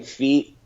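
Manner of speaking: "feet" slow, the final stop shut without release; closed-off ending. Shown as a demonstration. Teacher mirroring the learner's accent, "feet" closed off hard. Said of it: "closing it".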